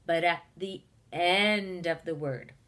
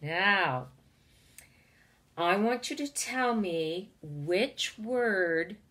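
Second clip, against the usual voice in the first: ordinary talk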